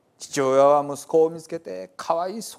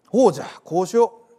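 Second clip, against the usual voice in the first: quick, loud, higher burst of resolve; sudden idea; 5 semitones higher, 7 dB louder, faster than usual. A narrator's reading. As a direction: exclaim as the solution strikes you. Steward suddenly has an idea.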